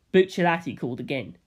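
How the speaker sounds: ordinary delivery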